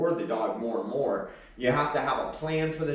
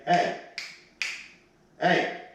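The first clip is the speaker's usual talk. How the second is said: sharp hey to dog; 6 semitones higher, 4 dB louder than usual. Real, not acted. Calling the dog to stop licking.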